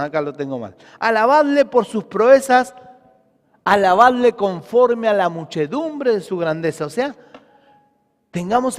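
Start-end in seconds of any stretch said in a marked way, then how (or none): 1.0-6.9 louder, emphatic psalm reading; preaching scripture. Acted. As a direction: proclaim the verse to the congregation. Reads the psalm aloud, preaching. (lectura del salmo predicada con fuerza)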